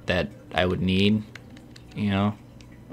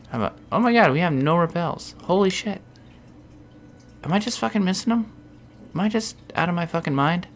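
much higher, frantic; real frantic exasperation; exasperated disbelief; 9 semitones higher than usual. Real, not acted. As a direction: go up in panicked disbelief. No repels in bag.

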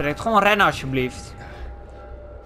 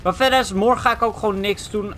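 ordinary talk

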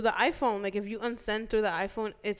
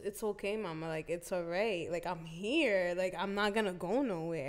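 soft, low, soothing voice; gentle reassurance; 3 semitones lower, 8 dB quieter than usual. Retold or imitated. Ex comforting a crying caller, tender reassurance. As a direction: quietly comfort someone who is crying.

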